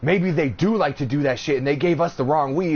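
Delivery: half-hearted paranoid voice; mock paranoid. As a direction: replay the stoned worry lightly. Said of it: "I was all paranoid".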